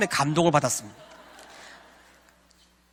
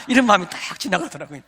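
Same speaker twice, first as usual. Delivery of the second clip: ordinary talk, nothing marked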